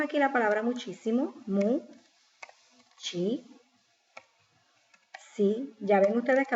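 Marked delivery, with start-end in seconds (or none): 1.4-5.7 isolated syllables with a pause between; sílaba por sílaba. Shown as a demonstration. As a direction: sound out the word piece by piece. Divides the word into syllables. (separando en sílabas)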